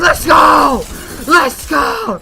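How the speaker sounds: high, loud victory yell of Let's go; hype shout. Retold or imitated. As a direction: scream it like you just won the round. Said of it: "and I'm like".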